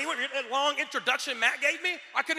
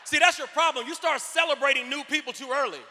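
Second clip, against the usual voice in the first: playful scolding shout; 4 semitones higher, 8 dB louder than usual. Real, not acted. Scolding audience for cheering.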